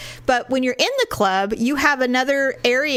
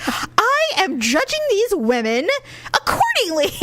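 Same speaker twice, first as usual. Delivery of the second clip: mock haughty; 9 semitones higher than usual. Retold haughty defence of her judging.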